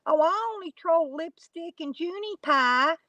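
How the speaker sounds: high, prissy imitation of the troll; mocking the troll. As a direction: parrot her in a high voice. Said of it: "the next minute she says".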